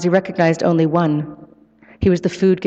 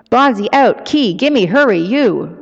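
higher, louder insistent signing; urgent bonobo; 6 semitones higher, 4 dB louder, slower than usual. A narrator's reading. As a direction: punch out each word as an urgent demand. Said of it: "repeated her assertions in ever more urgent motions".

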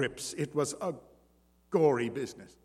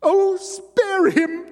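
much higher, louder, slow begging; desperate pleading; 8 dB louder, slower than usual. A narrator's reading. Wife begs her husband to spare Simon, pleading. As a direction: cry out for mercy. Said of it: "Jeanne-Marie implored".

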